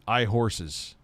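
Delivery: ordinary talk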